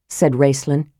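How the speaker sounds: ordinary delivery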